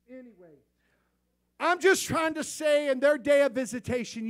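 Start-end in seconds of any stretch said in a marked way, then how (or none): 1.6-4.3 preaching shout. Real, not acted. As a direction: bring it home to the congregation at full voice.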